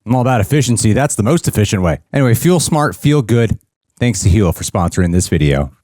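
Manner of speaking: upbeat sponsor read, brisk. Acted. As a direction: sell it like a sponsor spot.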